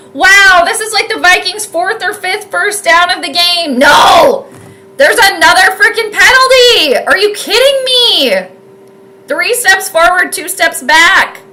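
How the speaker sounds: exasperated outburst at the refs; frustrated shout. Real, not acted. Yelling at penalty on TV.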